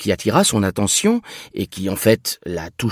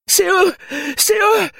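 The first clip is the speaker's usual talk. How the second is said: panicked cry. Acted. Woman points at her pursuers.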